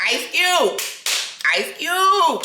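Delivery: high, breathless repeat of the name; starstruck call. Retold or imitated. Starstruck kid calling out the name.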